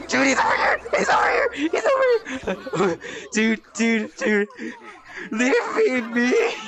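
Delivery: high, frantic yells half laughing in fright; panicked screaming laugh. Real, not acted. Monster chasing him, screaming.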